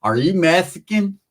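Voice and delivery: slow, lisped question; mock a toothless woman, lisping "Mexican"; one-toothed lady. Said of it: "the lady had one tooth".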